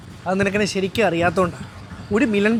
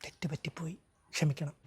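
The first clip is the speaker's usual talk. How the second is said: very soft, low admission; subdued apology; 5 semitones lower, 17 dB quieter than usual. Acted. Man apologizing to the woman he fought with, subdued. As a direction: own up almost under the voice. Grudging apology after the quarrel.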